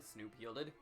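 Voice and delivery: normal talking voice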